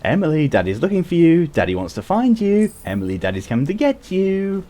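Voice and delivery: a playful dad that sounds creepy; light-hearted voice. Retold or imitated. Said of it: "trying to say it in a light-hearted voice sounds creepy".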